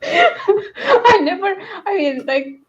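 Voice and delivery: breaking into helpless laughter, high; laughing too hard to finish the thought. Real, not acted. Podcast host cracking up at her own thought, laughing. Laughing fit mid-sentence.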